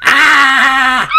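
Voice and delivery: high, loud storybook scream; frightened shout. A narrator's reading. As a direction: scream as the startled little friends. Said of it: "everyone shouts".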